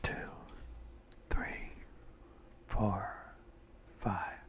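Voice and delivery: slow, mostly whispered breathing count; guided breathing meditation. Acted. Guide a friend through breathing in and out, hushed meditation voice.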